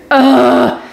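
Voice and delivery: high groan of pain; imitated pain noise. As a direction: groan as if in agony. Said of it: "I was making noise 'cause I was in so much pain".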